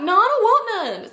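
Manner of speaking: high, huffy refusal; pouty friend. Retold or imitated. Leslie refusing a burrito, high and huffy. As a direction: brush off the offer with a huff.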